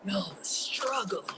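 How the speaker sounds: slow whispered sigh; slow hushed lament; weary whisper. Real, not acted. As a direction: whisper it worn out. Sighing over the ordeal.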